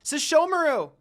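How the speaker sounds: loud, high call across a distance; yelling a name. Acted. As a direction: shout his name as you run to him. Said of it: "Kagome yelled out his name".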